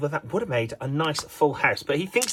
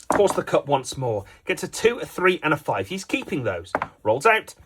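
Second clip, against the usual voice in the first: normal talking voice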